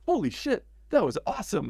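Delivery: high-pitched retold amazement; amazed reaction. Director thrilled after the drummer stood up for him, amazed. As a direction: relive being awestruck, voice lifting.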